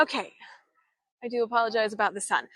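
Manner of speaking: usual speaking voice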